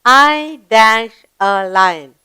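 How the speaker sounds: very slow, deliberate reading that leaves a gap for the verb; slow gapped reading. Shown as a demonstration. Teacher reads the lion's gapped sentence to pupils. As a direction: read it slowly and pause at the blank. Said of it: "Let's see what he says".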